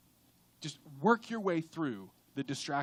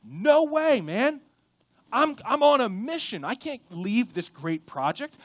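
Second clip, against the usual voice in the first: firm refusal; 3 semitones higher, 7 dB louder than usual. Acted. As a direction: refuse with all your courage. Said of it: "your reply with all your heart".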